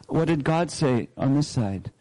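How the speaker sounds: ordinary talk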